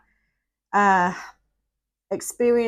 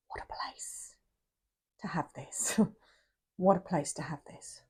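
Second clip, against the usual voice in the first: hushed, near-whispered, overcome; real choked-up whisper; emotional hush; 2 semitones lower, 10 dB quieter than usual. Real, not acted. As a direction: barely get it out, moved to a whisper. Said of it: "I'm really feeling emotional".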